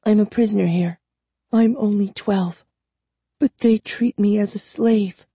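high, plaintive lament; understated tearful girl's voice. A narrator's reading. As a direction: complain close to tears, pitched high. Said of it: "Tears welled in her eyes".

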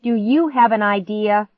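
loud, high challenge; yelling. A narrator's reading. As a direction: snap it back at your brother. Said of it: "I yelled at him".

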